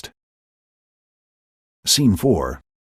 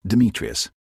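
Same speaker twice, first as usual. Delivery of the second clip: ordinary delivery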